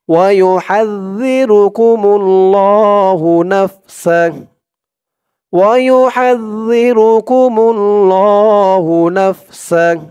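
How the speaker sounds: slow, drawn-out Quranic chant; tajweed recitation. Shown as a demonstration. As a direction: recite it slowly with long held vowels. (ধীরে টেনে কুরআন তিলাওয়াত)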